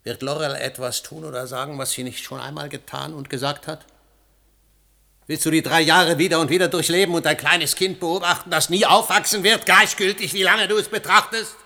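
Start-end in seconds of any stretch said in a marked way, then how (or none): 5.3-11.6 louder and rising, bitter scorn; angry tirade. Acted. Husband berates his grieving wife, rising angry tirade. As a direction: hammer each word at her.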